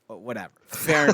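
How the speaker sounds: normal talking voice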